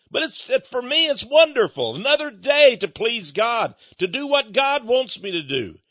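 pitched high and loud with real delight; beaming, rising enthusiasm about serving God; joyful exclamation. Real, not acted. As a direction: rave about how wonderful the job is. Exulting in working for God.

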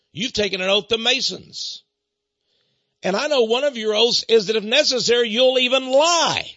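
re-enacted heated challenge; forceful accusation. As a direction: confront the caller, driving the charge home. Acted quarrel, confronting the caller.

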